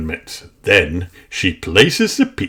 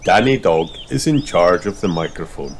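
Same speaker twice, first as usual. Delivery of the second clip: usual speaking voice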